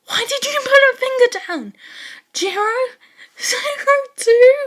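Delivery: high, loud, near-crying outcry; dismayed wail. Acted. Teacher wailing at students' confessions, high and loud. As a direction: wail it in despair at the class.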